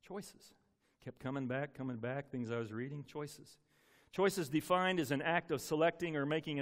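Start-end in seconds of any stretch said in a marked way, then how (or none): 0.1-0.5 hushed echo. Retold or imitated. God's word, echoed. 3.0-3.6 hushed echo. Retold or imitated. God's word, echoed.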